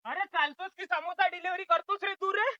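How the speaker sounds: called out high after a friend walking off; teasing call after a buddy. Acted. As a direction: shout after him to stop and wait. Calling after friend to wait.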